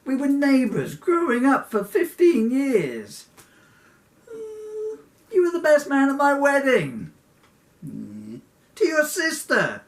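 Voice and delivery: high, loud, hammed-up yell; acted outburst at the top of the voice; exasperated shouting. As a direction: shout each reminder in mounting disbelief. Ghost exasperated at not being remembered.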